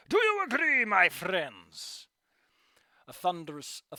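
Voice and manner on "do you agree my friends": big showman call to the crowd; larger than life. Acted. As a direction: rouse the whole crowd. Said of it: "his voice larger than life".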